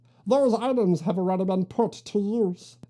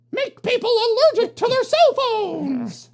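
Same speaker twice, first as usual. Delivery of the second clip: shocked exclamation; 12 semitones higher, 5 dB louder than usual. Acted. Outraged realisation of the plan.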